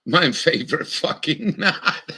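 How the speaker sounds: laughing through the words. Real, not acted. Fisherman laughing as he names his favorite knot. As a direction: giggle out favorite knot, high.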